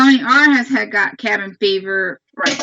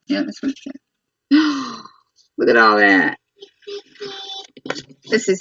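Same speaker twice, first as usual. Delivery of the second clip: storybook read-aloud voice to little ones; 3 semitones higher than usual. Real, not acted. Mother reading a farm book to her children, storybook voice.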